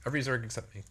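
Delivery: ordinary delivery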